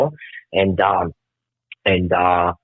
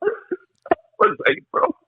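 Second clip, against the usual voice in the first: choked up. Real, not acted. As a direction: voice breaking high into real tears. Breaks down remembering dead friend.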